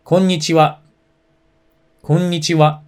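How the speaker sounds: a clear teacher's konnichiwa; model for repetition. Shown as a demonstration. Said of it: "Repeat after me".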